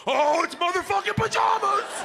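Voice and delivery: high, gleeful outburst from dad; dad's delighted shout. Retold or imitated. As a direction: crow it with glee. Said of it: "he was like".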